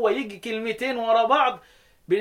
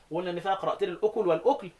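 usual speaking voice

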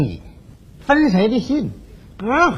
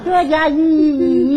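higher, slow, stretched-out syllable; mocking drawn-out wail; 6 semitones higher, much slower than usual. Suitor mocking the woman's monotonous crying, drawn-out imitation. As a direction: mimic monotonous crying on one long note. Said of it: "这要哭出点花样也行".

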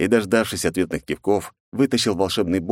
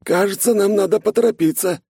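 companion, pitched well up and loud; high companion voice; 14 semitones higher, 4 dB louder than usual. A narrator's reading. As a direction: urge them on in a high voice. Snegir urges hurry.

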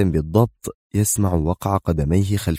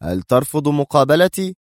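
angry outburst; 8 semitones higher than usual. A narrator's reading. Lawyer shouts at the guard who bars him, angry. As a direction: fling the question at him in anger. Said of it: "صاح به المحامي في غضب".

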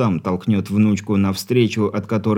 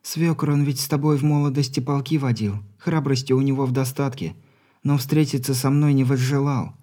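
resolute granddaughter, higher and a little softer; firm young woman's voice; 5 semitones higher, 3 dB quieter than usual. Acted. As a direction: speak firmly through held-back tears. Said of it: "А вот теперь голос твёрд".

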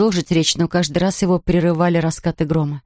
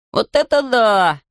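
higher, slow wondering cry; excited exclaim; 4 semitones higher, slower than usual. A narrator's reading. Jonathan points at the warehouse, amazed higher cry. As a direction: call out amazed, drawn out. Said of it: "внезапно закричал Джонатан".

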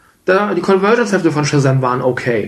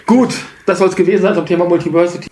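ordinary delivery

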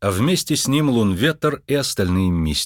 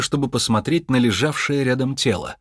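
ordinary delivery, nothing marked

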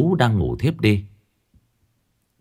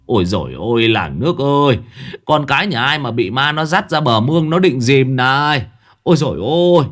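loud panicked cry for the village, higher; shouting for help; 4 semitones higher, 4 dB louder than usual. A narrator's reading. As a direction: yell to the crowd while running. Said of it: "vừa chạy vừa hô to". (hô to hoảng hốt, cao và lớn)

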